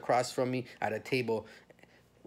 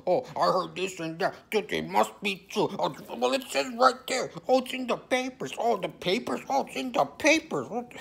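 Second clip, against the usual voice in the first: mocking gullible voice; 8 semitones higher than usual. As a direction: parody someone who believes whatever the papers say. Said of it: "I'm not gonna just".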